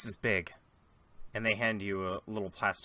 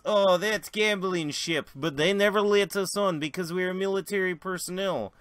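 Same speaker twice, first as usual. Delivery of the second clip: put-on Russian accent; 7 semitones higher, 5 dB louder than usual. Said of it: "the Russian looks over and goes".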